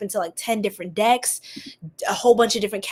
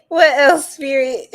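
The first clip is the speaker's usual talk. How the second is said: laughing; 5 semitones higher, 8 dB louder, slower than usual. Real, not acted. Laughing while asking spirit.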